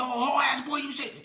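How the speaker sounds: high loud angry yell; furious yell; charging yell. Retold or imitated. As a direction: rage at him as he charges.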